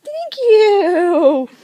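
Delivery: high, loud laughing thanks. Real, not acted. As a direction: burst out laughing with joy. Laughing delight.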